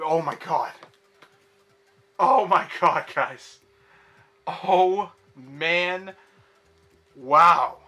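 loud, high thrilled yells; excited shout. Real, not acted. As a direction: shout out a big pull. Huge pull from final pack.

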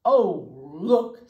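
loud alarmed bear calling out; a startled father bear crying look; Papa Bear. Acted. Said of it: "said Papa Bear".

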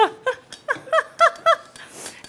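loud high laugh bursting out; real laugh at the teary cameraman; high burst of laughter. Real, not acted.

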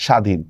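স্বাধীন as "shadhin", no b or w; silent b. Shown as a demonstration. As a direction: drop the b after the sh in স্বাধীন. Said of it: "বফলার উচ্চারণ হচ্ছে না".

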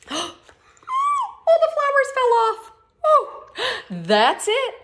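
high, amazed gasping cry; excited surprise. Acted. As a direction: gasp it out in wonder for the children. Reading surprise to kids.

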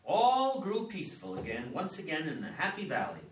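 lilting storybook line, swooping up on "All"; ritual recitation. Acted. Father starting an old family ritual, reciting with a lilt.

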